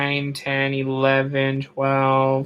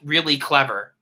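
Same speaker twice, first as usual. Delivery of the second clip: usual speaking voice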